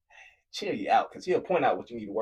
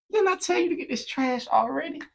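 much higher, loud and exasperated; impatient yell; 4 dB louder than usual. Retold or imitated. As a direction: blow up at the roommate. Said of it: "not having patience".

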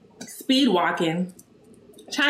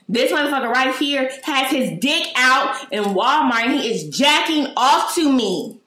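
raised, higher voice, pressing every detail; retold yelling; 5 semitones higher than usual. Shopper pointing out the man to the store, raised retold yelling. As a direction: call him out for everyone to hear. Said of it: "I'm still yelling".

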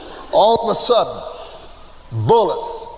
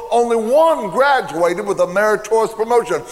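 ordinary talk, nothing marked

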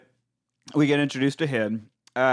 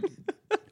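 howling; 7 dB quieter than usual. Retold or imitated. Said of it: "howling and shit".

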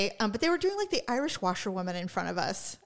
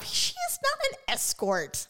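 high, slow, disbelieving protest; incredulous; slower than usual. Retold or imitated. Telling Daryl off about the couple, high slow disbelief. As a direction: draw it out high in mock disbelief. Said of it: "I'm like".

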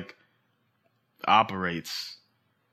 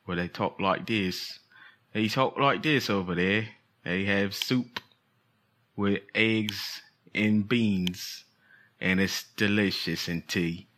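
put-on European accent; 3 dB quieter, slower than usual. Host guessing how the European guest talks, slow mock European accent. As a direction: attempt a European accent, drawn out slowly. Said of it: "was that a good accent".

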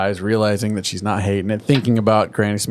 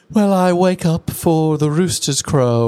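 higher, slow, put-on refined voice; prim posh pony; 6 semitones higher, slower than usual. Applejack now poshed up, answering at dinner in a slow refined voice. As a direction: pronounce it like a poshed-up lady.

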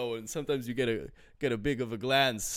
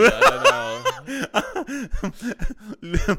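laughing; 10 semitones higher, 9 dB louder, much slower than usual. Real, not acted. Laughing breaks speech.

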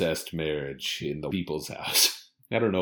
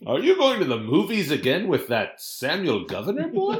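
mock mother voice; 9 semitones higher, 3 dB louder than usual. Acted cousin scene.

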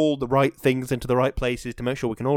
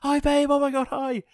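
much higher, giddy retold hello to wife; imitating himself greeting his wife all giddy; gushing greeting; 13 semitones higher than usual. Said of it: "and I was like".

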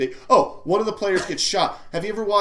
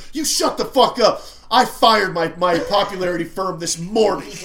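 mock furious, raised voice; angry outburst; 3 dB louder than usual. Coach telling off doubters of his seriousness, acted angry outburst. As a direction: snap back as the hammed-up offended coach.

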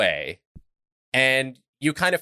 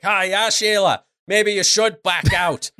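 rowdy dwarf voice; 11 semitones higher, 4 dB louder than usual. Acted. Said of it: "just like".

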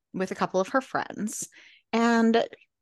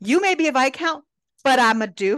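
loud, raised lordly retort; acted duke throwing his weight around; pulling rank; 5 semitones higher, 6 dB louder than usual. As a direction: assert your title forcefully. George pulls rank on James.

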